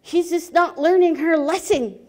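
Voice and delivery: getting angry. Acted. Chief fed up with Frog Woman, angry complaint. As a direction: complain with rising anger. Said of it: "Now he's really getting angry".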